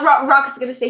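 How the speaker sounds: normal talking voice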